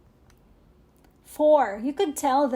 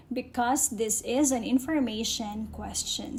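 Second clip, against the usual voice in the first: usual speaking voice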